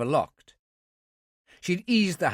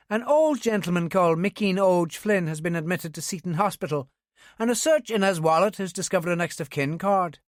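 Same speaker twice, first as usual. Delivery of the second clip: higher, brisk Belfast sergeant on the phone; young Ulster policewoman; 5 semitones higher than usual. A narrator's reading. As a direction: voice a young Northern Irish policewoman. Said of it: "the unmistakable Ulster tones of a young woman".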